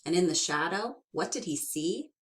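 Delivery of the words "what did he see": plain reading voice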